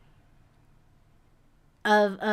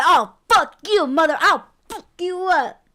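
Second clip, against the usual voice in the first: raised, higher quarrelling voice; mock breakup rage; 6 semitones higher, 5 dB louder than usual. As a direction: lash out like a bitter ex. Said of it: "when y'all break up".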